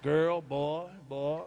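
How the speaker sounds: deep low groan of a word; exasperated aside. Real, not acted. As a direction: drop low, shaking your head. Exasperated exclamation.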